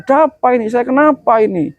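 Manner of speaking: high, frantic bewildered cries; panicked soul. Acted. Preacher voicing a dead man seeing his family weep, high frantic cries. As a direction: cry out lost and terrified.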